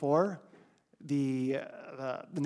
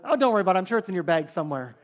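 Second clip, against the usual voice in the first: much higher and louder, carefree and quick; breezy reassurance; 5 semitones higher, 8 dB louder, much faster than usual. Retold or imitated. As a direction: toss it off like nothing's wrong. Don brushing off the missing passport.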